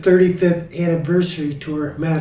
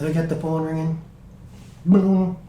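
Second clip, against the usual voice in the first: ordinary delivery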